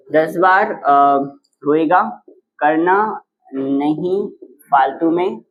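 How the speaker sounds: measured recital with gaps between words; word-by-word dictation. Shown as a demonstration. Teacher reciting the trick for students to write, word-by-word dictation. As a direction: dictate it in separate pieces, pausing after each.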